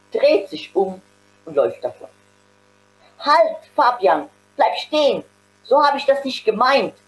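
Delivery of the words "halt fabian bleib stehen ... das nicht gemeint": calling after him. A narrator's reading. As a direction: call him back before he's gone. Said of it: "ruft Max ihm hinterher". (Max ruft dem weggehenden Fabian laut hinterher)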